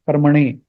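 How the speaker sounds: karmane with the N curled back; tongue tip back for the N in karmane; retroflex N. Shown as a demonstration. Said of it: "retroflex N".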